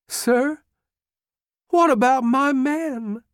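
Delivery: put-on a bewildered boy begging for help; tearful plea. A narrator's reading. Broken general pleading to Lee for his men, high tearful voice. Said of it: "Pickett said tearfully".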